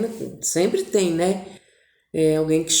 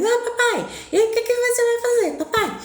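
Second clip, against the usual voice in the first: put-on much higher, coaxing girl's voice; sweet little girl. Daughter sweetly begging daddy to tell, girlish. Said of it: "Aí a Leana era mais".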